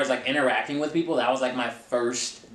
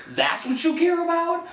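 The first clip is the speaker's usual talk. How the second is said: incredulous retort. Retold or imitated. Retelling her confrontation with the boss.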